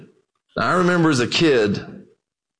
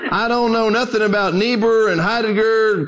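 put-on old preacher line said through a laugh, higher; 6 semitones higher than usual. Old preacher teasing the scholar, laughing. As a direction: chuckle through the words.

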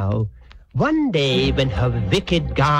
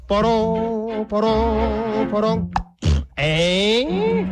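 high, hollow sustained horn calls; French horn imitation; 5 semitones higher, much slower than usual. Storyteller sounds the wicked guardian, hooting horn imitation. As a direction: toot the guardian's horn motif, then a held oh. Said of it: "said the French horn".

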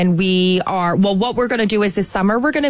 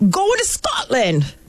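put-on slow, higher, clumsy Scottish accent; attempted Scottish accent; 5 semitones higher, slower than usual. Guest quoting her friends' invite in a bad Scottish accent. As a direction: try a Scottish accent and miss. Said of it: "Is that a Scottish accent?".

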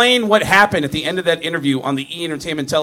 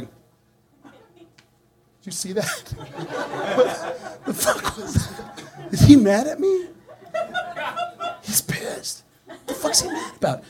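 whispered disbelief, laughing under breath; stunned whisper; 13 semitones higher, 6 dB quieter, slower than usual. Retold or imitated. Comic whispers to colleagues after Gene storms out. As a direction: hush it like he might hear.

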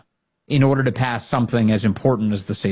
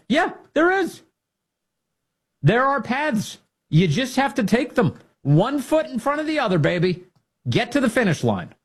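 rousing pep talk; 12 semitones higher than usual. Real, not acted.

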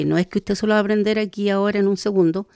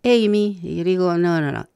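ordinary talk

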